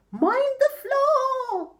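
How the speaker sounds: high, slow mother pig cry. Acted. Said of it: "Pig cried".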